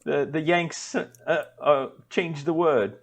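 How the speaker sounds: lightly put-on British accent, slow and disdainful; mock British sniff. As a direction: drawl it like a snooty Brit. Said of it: "and be like".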